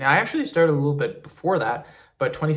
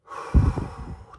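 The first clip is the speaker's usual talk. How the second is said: long, audible deep breath. Shown as a demonstration. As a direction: demonstrate one slow, full breath. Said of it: "take deep breaths".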